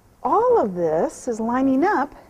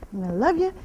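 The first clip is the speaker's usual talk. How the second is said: ordinary talk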